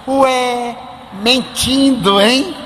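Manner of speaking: put-on high, slow teasing child voice; a child catching his uncle in a lie, slow and teasing; mocking child. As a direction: drag out mentindo like a child who caught you.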